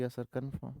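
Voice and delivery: soft mumbled yes sir confirm; soft pupil's yes sir; quiet student reply. Shown as a demonstration. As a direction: answer softly as the class. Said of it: "बोलोगे".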